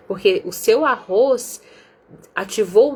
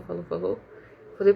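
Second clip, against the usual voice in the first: ordinary talk, nothing marked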